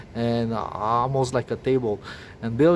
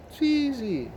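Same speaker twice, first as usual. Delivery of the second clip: very high but softer, scornful; fed-up sneer; 11 semitones higher, 4 dB quieter than usual. Real, not acted. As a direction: toss it off high and dismissive. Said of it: "me swearing now, having enough".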